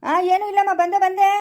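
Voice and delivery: calling out. Acted. Son calling back to his mother from afar. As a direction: shout across the field to her.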